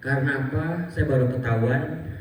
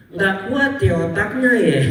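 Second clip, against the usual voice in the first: teasing aunt; 8 semitones higher, 4 dB louder than usual. Retold or imitated. Aunt at hospital bed teasing.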